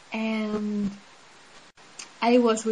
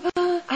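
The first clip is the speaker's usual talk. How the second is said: gasp; 8 semitones higher than usual. Retold or imitated. Panicked gasp in retold scene.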